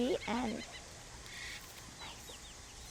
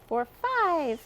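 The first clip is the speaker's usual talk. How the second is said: ordinary delivery, nothing marked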